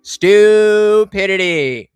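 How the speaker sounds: slow emphatic scorn; slow drawn-out. Real, not acted. Drawn-out verdict on the comment.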